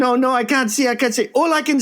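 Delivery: put-on high, half-hearted Japanese accent; a hint of a Japanese bar girl; mock Japanese accent. Retelling the Japanese bar girl's words, mock Japanese accent. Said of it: "lost my Japanese accent".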